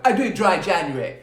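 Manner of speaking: loud, high mock boasting; hammed-up loud bragging; really loud voice. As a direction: announce it at full volume, mocking a show-off. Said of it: "Do it in a really loud voice".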